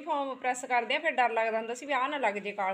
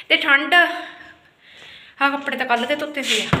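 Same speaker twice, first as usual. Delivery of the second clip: out of breath; 2 semitones higher, 6 dB louder, slower than usual. Real, not acted. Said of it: "ਸਾਹ ਚੜ੍ਹ ਗਿਆ ਮੈਨੂੰ ਤਾਂ".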